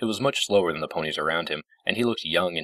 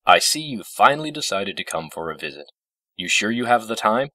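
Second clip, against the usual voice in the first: sour, pointed, louder and lifted; bitter spite; 5 semitones higher, 5 dB louder than usual. A narrator's reading. Discord greeting a long-awaited visitor, bitterly spiteful. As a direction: let spite drip from it. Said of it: "with bitter spite dripping from his words".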